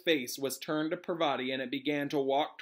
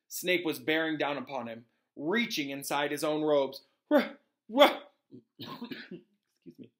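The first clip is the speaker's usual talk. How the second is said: plain reading voice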